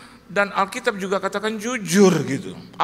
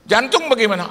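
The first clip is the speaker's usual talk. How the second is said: Spirit questioning; 6 semitones higher, 7 dB louder than usual. Retold or imitated. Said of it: "roh ngomong sama saya".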